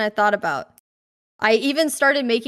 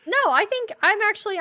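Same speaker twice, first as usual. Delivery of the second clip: pitched up, giggly, flustered; flustered high voice; 9 semitones higher than usual. Real, not acted. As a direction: scramble for the answer, laughing nervously. Flustered, laughing, piecing answer together.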